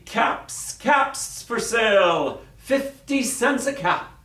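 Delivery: high, drawn-out street vendor's cry; calling out. Acted. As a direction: call your wares out across the town. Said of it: "walked back to town calling".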